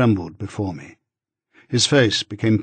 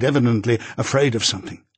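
ordinary delivery

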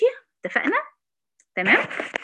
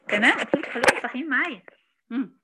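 usual speaking voice

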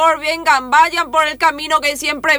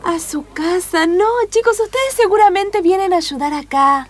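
higher, sweet new-age voice; hippie commune leader; 6 semitones higher than usual. Acted. Commune leader welcoming lost children, in a high, sweet hippie voice. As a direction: coo at the kids like a hippie guru.

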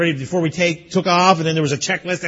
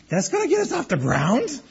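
mock disbelief; 6 semitones higher, 4 dB quieter than usual. Doubting the small engine.

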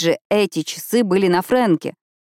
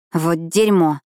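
exhaled curse, breathy; breathed out; slower than usual. Acted. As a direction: let it out on a shocked breath. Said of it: "выдыхаю я".

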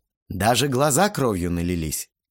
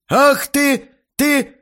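very high, loud sputter; speechless rage; 8 dB louder, much slower than usual. A narrator's reading. Red-faced guest can't find words, furious sputter. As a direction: choke on the insult in fury.

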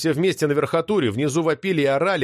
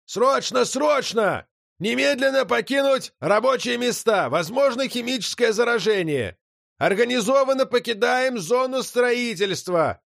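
booming public announcement, higher; thunderous loudspeaker voice; 9 semitones higher than usual. A narrator's reading. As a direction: blare it like a dozen loudspeakers. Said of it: "раздался громовой голос Обжоры".

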